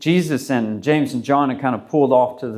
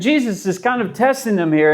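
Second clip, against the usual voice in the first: ordinary talk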